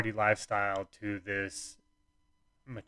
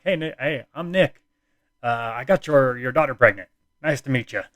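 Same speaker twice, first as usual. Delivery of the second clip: higher and louder, overly cheerful hello; loud eager greeting; 4 semitones higher, 9 dB louder than usual. Retold or imitated. Meeting girlfriend's dad for the first time, loud cheerful greeting. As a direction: blurt it out brightly like it's nothing.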